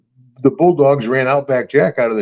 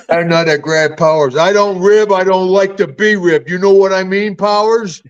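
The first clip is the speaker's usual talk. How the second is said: higher and louder, a touch of threat; mock stern warning; 6 semitones higher, 3 dB louder than usual. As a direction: warn the prankster off, half in jest. Confronting Owen on the phone.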